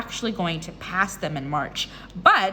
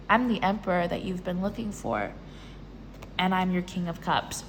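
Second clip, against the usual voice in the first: low, soft lover's introduction; suave suitor; 6 semitones lower, 6 dB quieter than usual. Acted. Love interest introducing himself to Aquarius, suave and low. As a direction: play the smooth Emperor, quieter and deeper.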